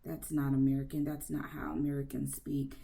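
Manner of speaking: a hint of a nitpicking linguist; picky linguist. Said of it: "listened to you and said".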